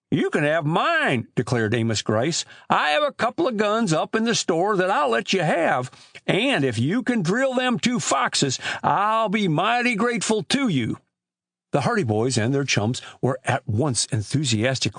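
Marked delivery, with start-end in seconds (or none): 0.1-1.3 put-on high, slow rustic old man; Amos Grice. Old storekeeper telling boys of lost chickens, high drawling voice. 2.7-11.0 put-on high-pitched rustic old man; old country storekeeper. Old storekeeper grumbling to boys about chicken thieves, high rustic voice.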